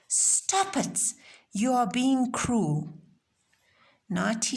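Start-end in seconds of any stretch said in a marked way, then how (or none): 0.1-2.9 acted high-pitched outburst, starting sharply up; high, emphatic telling-off; indignant scolding. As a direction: cry out to halt the bullies, storybook style. Child confronts stone-throwers in story.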